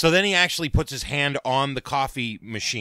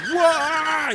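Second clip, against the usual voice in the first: very high drawn cry; high hammed-up wail; pained cry; 3 dB louder than usual. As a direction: cry out in burning pain. [name] still in pain.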